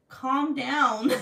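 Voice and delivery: loud, high mock exasperation; retold exasperated outburst. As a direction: raise it sharply, as if to a jealous kid. Retold telling jealous son off.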